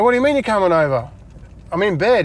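baffled protest. Retold or imitated. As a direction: retell it as a puzzled objection. Retold protest at late visit.

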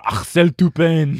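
put-on loud French accent on the name; projected French pronunciation. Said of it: "making you talk in a French accent".

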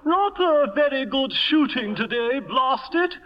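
high, hearty upper-class toff voice; Lord Bottomley impression. [name] mimics Lord Bottomley for Sherry, hammed-up toff. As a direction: ham up a blustering English lord. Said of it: "No one sounds like that".

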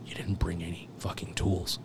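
hushed imitation of an angry boss; scolding done as a whisper; whispered mock scold. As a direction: whisper the chewing-out. Angry deputy chief retold.

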